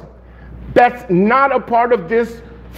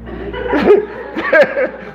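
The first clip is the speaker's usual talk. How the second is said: breathy laugh; 5 semitones higher than usual. Real, not acted. Laughs at own punchline.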